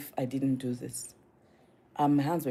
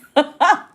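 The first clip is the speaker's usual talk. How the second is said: high, loud burst of laughter; loud amused laugh; real laugh; 11 semitones higher, 10 dB louder than usual. Real, not acted. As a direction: crack up at your own story. Laughs at the in-laws joke.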